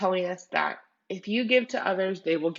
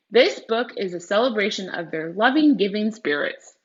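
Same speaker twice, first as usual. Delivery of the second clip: earnest read-aloud of the author's note, louder than her chat; 3 semitones higher, 7 dB louder than usual. Acted. Teacher reading the author's note to students, earnest read-aloud. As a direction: read the note to the class with care.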